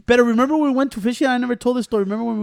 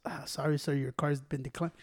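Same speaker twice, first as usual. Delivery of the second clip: imitated cashier breaking it gently; apologetic cashier; 6 semitones lower, 14 dB quieter than usual. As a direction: drop it quiet and deliver the bad news. Plays cashier announcing the decline.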